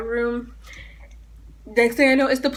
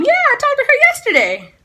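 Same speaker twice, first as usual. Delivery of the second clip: high chirpy voice; 5 dB louder than usual. Retold or imitated. Ideal cheerful answer to police.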